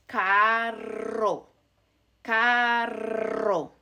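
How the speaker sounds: carro with a long trill; strong rolled R. Shown as a demonstration. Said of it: "this is a strong R sound".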